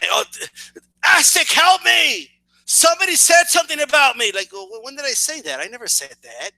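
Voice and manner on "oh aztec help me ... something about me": mock plea for help. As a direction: play a helpless victim mockingly. Mocks rival asking for help.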